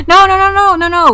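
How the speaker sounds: high, loud, rapid-fire refusal; hammed-up insistent objection; playful protest. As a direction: hurriedly wave off the idea in mock alarm. Playfully refusing to eat fellow vampires.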